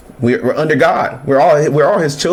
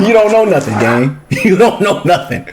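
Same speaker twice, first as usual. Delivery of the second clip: laughing taunt; 4 semitones higher than usual. Real, not acted. Mocking through laughter.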